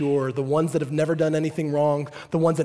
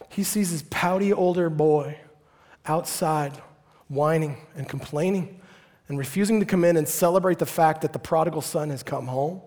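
ordinary talk, nothing marked

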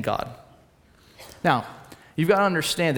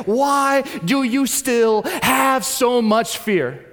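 higher, louder, slow challenge; preaching challenge; 6 semitones higher, 4 dB louder, much slower than usual. Acted. Pastor challenging the church, higher, louder and slow. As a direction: press the question home.

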